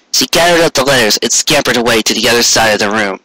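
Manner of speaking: ordinary delivery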